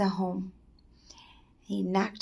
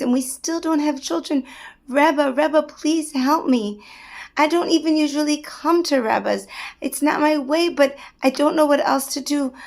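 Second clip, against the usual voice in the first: louder, higher begging rabbi; desperate pleading; 4 semitones higher, 5 dB louder than usual. Acted. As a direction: beg the Rebbe for a blessing. Rabbi begs the Rebbe.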